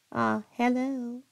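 a light put-on voice, checking the likeness; trying on her voice. Streamer tries on another woman's voice, lightly mocking. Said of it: "do I sound like her?".

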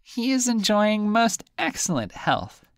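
high, squeaky little fish voice; high teasing fish; talking fish. Acted. Fish answers.

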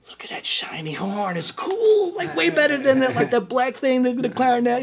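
high-pitched imitation of a dazzled child; awed kid. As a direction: marvel like a kid at a shiny new thing. His child self admiring the horn.

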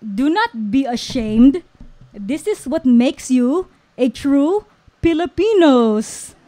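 put-on loud, emphatic Filipino accent; grandfatherly Filipino-accented pronouncement. A narrator's reading. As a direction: proclaim it with a Filipino accent, like Lolo. Grandfather Lolo's voice in the story.

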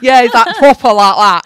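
loud, high mock Mancunian; exaggerated broad Manchester accent; northern English accent. Host joining in on the northern friend, loud Manchester accent. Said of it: "He is so northern".

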